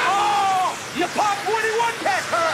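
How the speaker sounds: high, loud shocked yelp; shocked shriek. Acted. Boss yelling as the parade balloon bursts, shocked shriek. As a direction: shriek in dismay.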